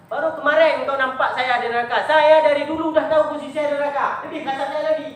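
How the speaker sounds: loud, high firm teacher's reply; emphatic old teacher. Acted. Teacher tells his student he always knew, loud and firm. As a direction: let the teacher answer with weight.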